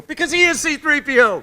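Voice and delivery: high, loud, prissy robot butler voice; excited C-3PO voice; C-3PO impression. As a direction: burst out as the fussy gold robot. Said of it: "I love when you do an accent".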